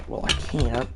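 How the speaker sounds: ordinary delivery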